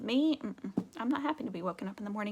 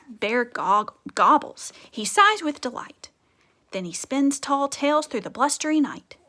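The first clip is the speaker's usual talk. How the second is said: put-on lively, louder read-aloud for kids; storybook reading; 5 dB louder, slower than usual. A narrator's reading. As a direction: read it like a bedtime book full of rhythm. Teacher reads picture book aloud.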